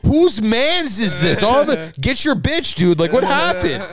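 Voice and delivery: high, slow alarmed questions; mock alarm. Friend asking who owns cocky Gabe, high alarm. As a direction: pitch up, demanding answers. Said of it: "I'm like".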